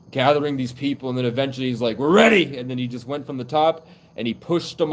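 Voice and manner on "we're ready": high, loud rallying cry; battle-ready call. Retold or imitated. Ancestor announcing to his gathered people, calling out loudly.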